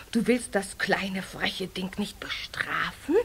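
low, soft whispered question. Acted. Weather witch whispering to the chief witch, soft whisper. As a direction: whisper it slyly. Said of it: "weather witch whispers to chief".

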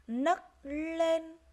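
"Nấc lên" loud and high, held N, held L; careful N and L. Shown as a demonstration. Teacher modelling "Nấc lên" for pupils, distinct N then L. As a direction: separate "Nấc" and "lên", leaning on each first consonant. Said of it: "nghe cô đọc lại các từ này".

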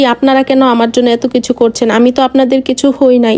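plain reading voice

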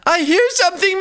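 very high, frightened storybook voice; scared. A narrator's reading. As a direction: squeak it out in mock fear. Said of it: "The Golly sisters are scared".

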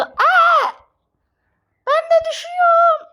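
high fast scream; scream while falling. Real, not acted. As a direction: yell as you fall. Falling again. (düşerken çığlık)

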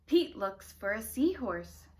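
ordinary delivery, nothing marked

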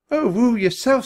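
high, loud, playful retort; playful hooting back. A narrator's reading. Annie answers the baboon while petting it, playful hooting. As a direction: hoot it back in fun. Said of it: "laughing and reaching out to pet Koku".

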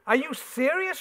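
shocked squeal, first word loud; sister's shocked squeal at her father. Acted. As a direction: squeal it out in disbelief. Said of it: "she squealed".

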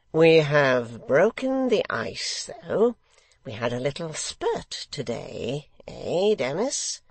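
slow, lilting secretary's voice; secretary, affable. A narrator's reading. Secretary sounding out the hangman, affable. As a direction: draw it out, rising on the ends.

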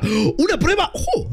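high-pitched mock shock at the news; asombro fingido. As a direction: gasp it out high, hammed-up. (asombro exagerado y agudo)

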